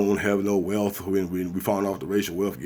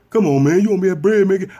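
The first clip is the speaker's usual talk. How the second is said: high, loud fast pleading; pleading creditor; 12 semitones higher, 8 dB louder, faster than usual. Retold or imitated. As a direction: beg for the money back. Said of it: "you come on like".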